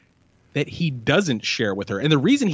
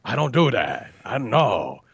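Pacino voice; 3 semitones lower than usual. Retold or imitated. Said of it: "he brings Devil".

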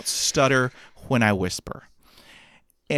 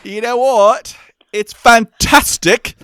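higher, louder mock UK accent; a hammed-up UK accent, louder and higher; put-on UK accent; 11 semitones higher, 13 dB louder, slower than usual. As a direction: show off the UK accent you slip into. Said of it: "there are times when I have an accent".

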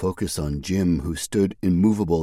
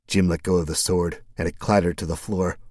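ordinary delivery, nothing marked

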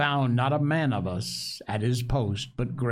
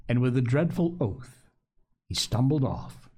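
ordinary delivery

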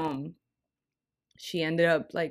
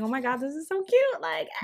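gushing; 11 semitones higher than usual. Retold or imitated. Gushing over date.

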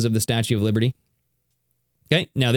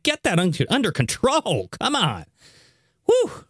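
ordinary delivery

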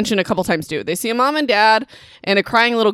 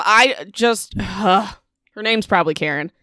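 ordinary delivery

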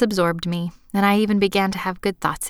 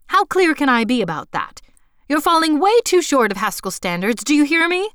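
higher and louder, relentless; wrathful scolding; 7 semitones higher, 5 dB louder than usual. Acted. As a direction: keep pounding her with fury. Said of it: "as if she couldn't turn off an internal faucet of her wrath".

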